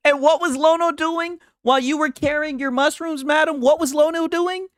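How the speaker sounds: high-pitched, teasing interrogation; hammed-up cross-examination; mock questioning. Mockingly questions madam about Lono.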